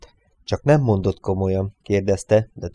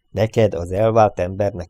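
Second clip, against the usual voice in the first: ordinary delivery, nothing marked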